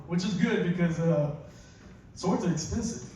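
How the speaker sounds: usual speaking voice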